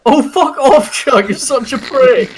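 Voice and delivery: high, loud scolding with a laugh breaking in; mock angry outburst. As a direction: tell him off in playful exasperation. Mock quarrel with character Chuck.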